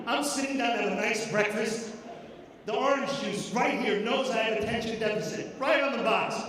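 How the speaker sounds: ordinary talk, nothing marked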